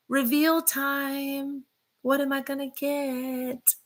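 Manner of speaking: high, drawn-out sing-song; excited singsong suspense; sing-song teasing. Real, not acted. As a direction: tease it out slowly in a high, soft lilt. Teasing her upcoming tattoo reveal.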